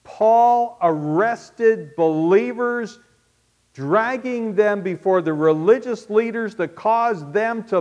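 loud, high, slow emphatic preaching; thundering sermon indictment; forceful preaching. Acted. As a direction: hammer each charge home.

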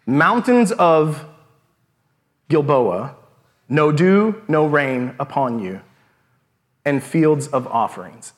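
slow, halting, words broken by long pauses; short terse breaths. Shown as a demonstration. Preacher renders the literal Hebrew for the congregation, halting and breathless. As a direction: gasp out each phrase as if out of breath. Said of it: "as if he's out of breath".

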